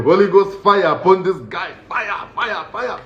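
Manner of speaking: high-pitched calling down of fire; hammed-up deliverance chant; mock prayer invocation. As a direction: chant it like a comic exorcist. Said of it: "I was calling".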